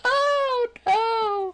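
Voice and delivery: very high, loud wail; drawn-out high-pitched moan; wailing dismay. Acted. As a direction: lament it in a squeal way up high. Desk trick fails.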